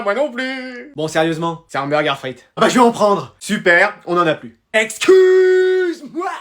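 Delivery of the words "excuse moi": long, drawn-out, high outraged excuse me. Acted. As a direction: stretch it out in disbelief. Shocked there's no food left.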